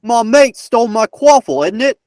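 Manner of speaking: high, hammed-up British voice; mock British accent. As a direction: put on a cheeky British accent. Gives clue sentence as mock Briton.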